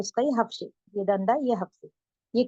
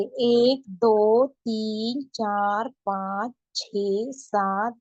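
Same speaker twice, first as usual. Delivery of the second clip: slow, drawn-out counting for students; teacher counting one to ten slowly; slow counting aloud; much slower than usual. Shown as a demonstration. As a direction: count each number clearly for learners. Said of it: "इसको इस तरीके से पढ़ेंगे".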